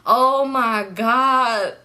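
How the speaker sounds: very slow, drawn-out mock dismay; whiny driver. Imitating the Uber driver fretting about cost, drawn-out dismay. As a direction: stretch each word like a fretting driver. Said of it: "So he was like".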